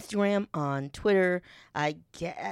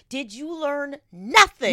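louder, higher, slow disbelieving demand; incredulous retort; 6 semitones higher, 7 dB louder, slower than usual. Retold or imitated. Scolding a president on his war speech, incredulous and loud. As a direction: hammer him with disbelief, slowly. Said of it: "And I was like".

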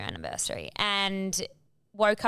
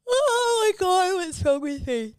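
excited squeal; 10 semitones higher, 4 dB louder than usual. Real, not acted. Friend gushing about the club music, squealing. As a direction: squeal it out with delight.

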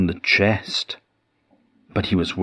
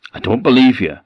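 higher, louder accusing retort; suspicious ghost snapping back a little louder; 5 semitones higher, 5 dB louder, faster than usual. Acted. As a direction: call his bluff sharply. Miser grows suspicious.

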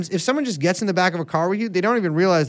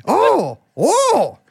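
high, lilting mock-Irish; badly faked Irish leprechaun at a party; Lucky Charms leprechaun; 5 semitones higher than usual. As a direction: open with high Irish oohs, then coax. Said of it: "do the Lucky Charms voice".

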